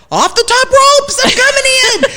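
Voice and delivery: hammed-up charge off the ropes; charging in. Speaker to a stranger, loud mock wrestler battle cry. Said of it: "I'm like".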